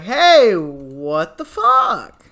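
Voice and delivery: sudden loud "Hey" then a slow exasperated drag; mock indignant demand; hammed-up outrage at the company; retold outburst. Angry quote to cable company.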